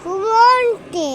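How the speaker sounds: high, loud, slow drawn-out shout; defiant yell. Real, not acted. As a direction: yell it back stubbornly. Child yells back in quarrel.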